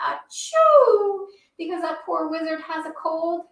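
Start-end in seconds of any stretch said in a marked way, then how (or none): 0.1-1.3 higher, louder playful fake sneeze for the kids; pretend sneeze. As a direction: ham up a wizard's sneeze. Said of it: "or I might say".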